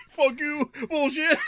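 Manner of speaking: high mock indignation; imitated offended comeback. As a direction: play a man who takes the joke seriously. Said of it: "he takes it all serious".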